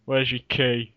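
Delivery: usual speaking voice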